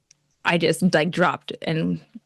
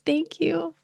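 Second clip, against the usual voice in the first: a much higher, light thank you; 11 semitones higher than usual. Retold or imitated. Miss Texas answering with a high, light thank you. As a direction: chirp it back high. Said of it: "She said".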